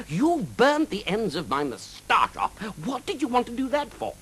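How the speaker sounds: high-pitched huffy knight voice; indignant knight. A narrator's reading. Knight confronts the dragon over his burnt moustache, indignant.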